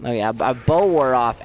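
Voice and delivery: ordinary talk, nothing marked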